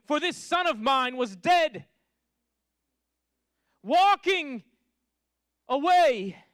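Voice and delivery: high, loud, exultant preacher's proclamation; full-force preaching. Acted. Preacher proclaiming the father's welcome home, loud and high. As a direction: proclaim the father's joy to the crowd.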